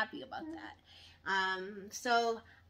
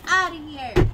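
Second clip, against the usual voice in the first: louder, higher mock exit line; storming off; 4 semitones higher, 13 dB louder than usual. Wife retelling leaving her husband, loud storming-off line. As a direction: slam it like you're storming out.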